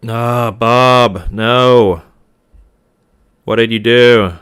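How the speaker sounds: loud groaning disbelief at a friend; exasperated outburst. Real, not acted. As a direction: call out a friend's blunder loudly. Friend makes bad trade, exasperated.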